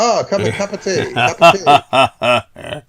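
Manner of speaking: teasing English accent mocking the tea obsession. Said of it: "It, it talks in an English accent".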